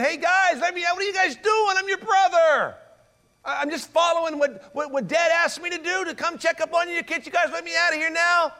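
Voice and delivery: high-pitched, pleading mock yell; imitated scream. Joseph begging his brothers from the pit, imitated screaming. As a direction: keep hollering up to be let out, voice pitched high. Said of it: "And he was screaming".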